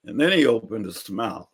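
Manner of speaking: usual speaking voice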